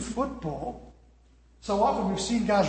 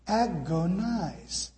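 slow drawn out; 4 dB quieter, much slower than usual. Shown as a demonstration. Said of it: "I'm gonna say it slowly".

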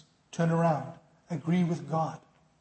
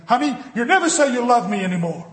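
complaining wife; 5 semitones higher, 7 dB louder than usual. Retold or imitated.

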